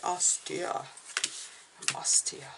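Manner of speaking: softly mock English accent, posh-educated; bad English accent. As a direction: put on a hammed-up English accent, hushed. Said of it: "an English, a very bad English accent".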